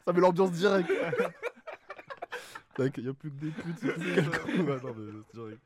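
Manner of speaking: talking between bursts of laughter; cracking up mid-sentence; laughing. Real, not acted. Cracking up at the question.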